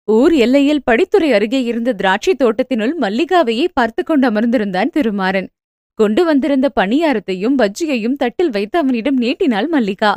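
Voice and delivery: usual speaking voice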